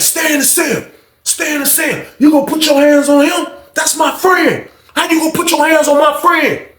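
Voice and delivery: high-pitched outraged demand; hammed-up indignation; acted confrontation. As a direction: stick up for a friend, voice way up. Acted confrontation over a friend.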